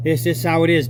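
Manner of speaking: ordinary delivery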